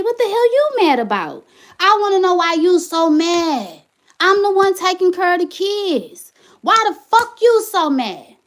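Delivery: high, heated questioning; angry rant. Real, not acted. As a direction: let the frustration spill over. Ranting at the ex.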